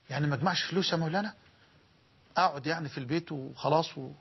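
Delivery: a hint of a puzzled listener asking; questioning listener. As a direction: rattle off the objection as a skeptical congregant. Voices an imagined objecting listener.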